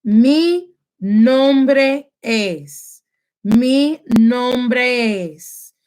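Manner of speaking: very slow, every syllable drawn out; said slowly. Shown as a demonstration. Teacher slowing down for the class, very slow. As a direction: stretch each sound apart so students hear it. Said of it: "So slowly".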